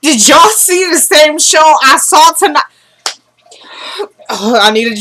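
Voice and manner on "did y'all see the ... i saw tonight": high, slow, breathless disbelief; can't believe what just aired; stunned excitement. Real, not acted. Host tells viewers, stunned by tonight's show, high and excited.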